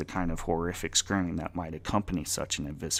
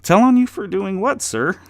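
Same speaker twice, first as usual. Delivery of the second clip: much higher and louder, playing dumb; feigned innocence; 14 semitones higher, 13 dB louder than usual. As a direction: act like you saw nothing. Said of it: "pretending not to see the headless corpse".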